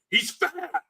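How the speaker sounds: high strained mock scream, held back in volume; Scott Steiner screaming. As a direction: imitate a wrestler screaming into the mic. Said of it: "just screams into his mic".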